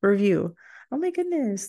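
high, laughing squeals of real joy; giddy laughter, voice way up; overjoyed, near tears. Real, not acted. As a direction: gush over the new review, close to crying. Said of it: "oh, I'm gonna cry".

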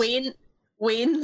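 "Wayne" and "Wayne's" said as "ween", "weens"; Scottish pronunciation. Shown as a demonstration. Scot tells players how to say it, Scottish pronunciation of "Wayne's". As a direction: demonstrate "Wayne's" with a Scottish ee vowel. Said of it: "tell us how to, how to say these words".

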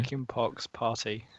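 soft, slow model of "Chicken pox party"; slow careful pronunciation. Shown as a demonstration. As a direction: say "Chicken pox party" slowly and quietly as a demo. Demonstrates the phrase for struggling co-host.

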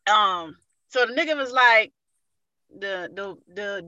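ordinary delivery

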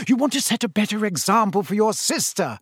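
high-pitched motherly scolding; snapped. A narrator's reading. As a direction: snap a reproach at the twins. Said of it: "snapped Missus Weasley".

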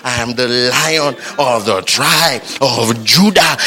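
slow, weighty, every word laid down; solemn proclamation. Acted. Preacher declaring who believers are, slow and solemn. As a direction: proclaim your royal lineage.

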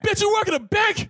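high, loud incredulous snap. Retold or imitated. Passenger snapping at the bank teller, loud high outburst. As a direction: blurt it out loud without thinking. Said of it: "Loud as shit".

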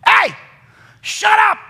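high, loud shout of hey, shut up; sudden shout. Retold or imitated. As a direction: bark it out to stop someone. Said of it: "the Holy Ghost ... goes".

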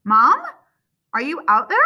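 high, loud questioning call. A narrator's reading. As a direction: call anxiously through a locked door. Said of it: "he calls, rattling the knob".

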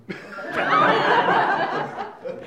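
a high, real laugh breaking out; sudden high laugh; burst of laughter. Real, not acted. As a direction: laugh at your own joke. Laughs at his own Don joke.

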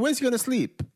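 put-on child's wondering voice. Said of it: "in my mind, I was thinking".

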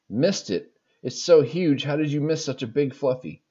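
a mock sergeant scolding in a light French-Canadian accent. Sergeant scolds the recruit over the fluff, mock French-Canadian bark. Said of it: "female French-Canadian sergeant".

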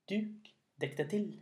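lifted spell-casting call; magic command. Acted.

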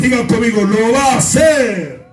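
fervent pastor lifting the congregation; preaching at full force. Real, not acted. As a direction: preach it over the music, half singing.

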